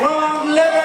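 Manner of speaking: high-pitched villain yelp; movie character cry. Acted. Pitching an Emperor's New Groove coaster, high cartoon cry. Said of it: "you're gonna hear them say".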